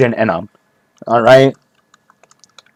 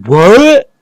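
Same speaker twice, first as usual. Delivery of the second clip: mock amazed; 9 semitones higher, much slower than usual. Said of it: "Be like".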